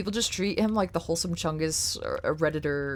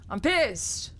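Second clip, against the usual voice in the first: pissed; 6 semitones higher than usual. Real, not acted. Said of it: "but I'm pissed".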